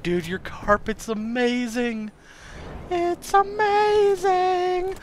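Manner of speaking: slow, high, drawn-out wonder; climbing into a very high, slow coo; tripping in awe. Retold or imitated. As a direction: stretch it out in stoned amazement. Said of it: "tripping balls on how amazing".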